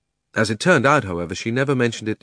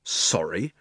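indignant; 3 semitones lower, 4 dB quieter than usual. A narrator's reading. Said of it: "exclaimed Diggory".

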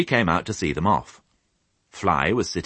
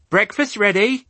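high, loud call from the window; 6 dB louder than usual. Acted. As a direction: call across the yard. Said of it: "she called".

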